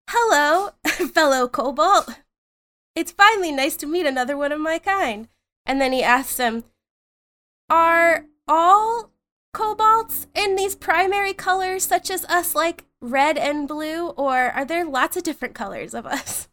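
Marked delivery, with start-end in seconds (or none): 0.1-5.3 high-pitched little kobold voice; eager friendly kobold. Acted. Pants greeting one of his own kind, high voice. 7.7-16.5 high, earnest little kobold voice; curious kobold. Acted. Pants asking a fellow kobold about colors, high voice.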